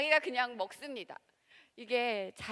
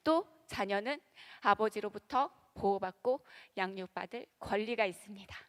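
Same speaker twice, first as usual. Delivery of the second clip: usual speaking voice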